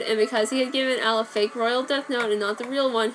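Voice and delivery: ordinary delivery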